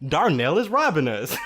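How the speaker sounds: loud, half-laughing shout; telling Leon about the robbery in a loud shout; shouted announcement. Retold or imitated. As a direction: yell the news to a coworker.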